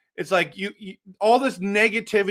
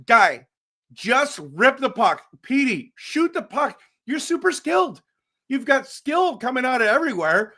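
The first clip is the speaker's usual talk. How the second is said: urging the player; 6 semitones higher than usual. Retold or imitated. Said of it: "we've been saying over and over".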